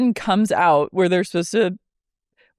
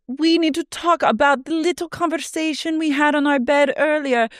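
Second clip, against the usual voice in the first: higher, sing-song catty imitation; mock Whitney; 8 semitones higher than usual. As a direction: mimic Whitney's pointed sweetness. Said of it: "Whitney goes".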